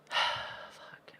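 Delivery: long breathy whispered sigh; sighed curse. Real, not acted. Player hearing distant roaring, sighed whispered curse.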